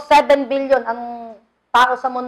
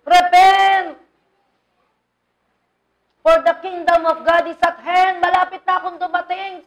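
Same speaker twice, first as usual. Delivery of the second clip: pleading cry; 4 semitones higher than usual. Retold or imitated. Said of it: "Umiiyak ang Panginoong Hesus".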